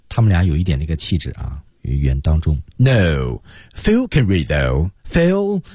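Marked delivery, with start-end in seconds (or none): 3.7-5.6 "Phil" stressed, then "Phil" called much higher; slow line leaning on "Phil", final "Phil" high. Shown as a demonstration. Teacher modelling the line for students, stressing Phil. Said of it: "人名作为关键词".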